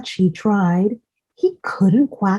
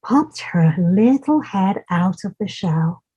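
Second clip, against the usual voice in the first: ordinary delivery, nothing marked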